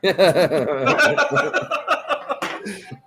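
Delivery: long, high-pitched cackle of real amusement; helpless high wheezy laughter; wheezing laugh. Real, not acted. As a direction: crack up into a drawn-out high laugh. Laughing at the drug refund joke.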